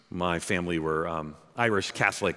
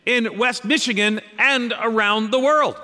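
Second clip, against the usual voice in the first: high, loud preacher delivery; ringing out at a raised pitch and volume; full-force preaching; 8 dB louder than usual. Real, not acted. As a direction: proclaim it to the whole congregation.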